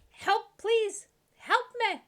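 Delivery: high, loud pleading for rescue; high cry for help. Acted. Captured cook yelling to the party for help. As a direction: beg to be saved, voice up high.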